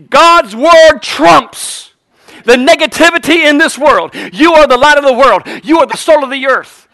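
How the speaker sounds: impassioned preacher in full flow; full-force preaching. Real, not acted. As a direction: drive each declaration out to the congregation.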